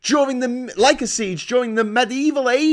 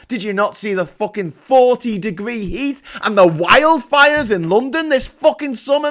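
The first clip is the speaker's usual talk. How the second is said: ordinary delivery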